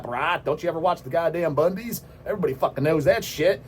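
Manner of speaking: usual speaking voice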